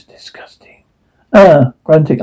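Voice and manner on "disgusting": hushed, voiceless whisper. Acted. Character reacting with disgust, in a whisper. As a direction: whisper it under your breath.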